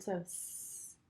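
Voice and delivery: s said as a long hiss, no voice; demo s sound. Shown as a demonstration. Therapist modelling the s sound for the patient, a long voiceless hiss. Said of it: "you're going to say the S sound".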